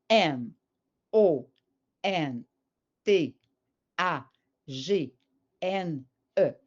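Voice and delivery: spelled out slowly, a pause after each letter; name each letter separately, leaving gaps for writing. Shown as a demonstration. Teacher showing how a child writes while pronouncing, slow letter-by-letter spelling. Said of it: "en prononçant chacune des lettres".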